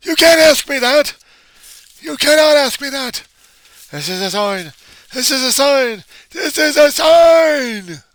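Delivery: high, screamed protest building to a long shout; conniption fit. Acted. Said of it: "a guy having a conniption fit and freaking out".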